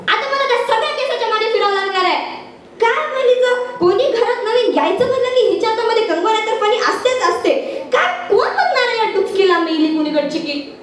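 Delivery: high-pitched, comic nagging voice; scolding household woman. Acted. Woman griping about hair everywhere, high scolding.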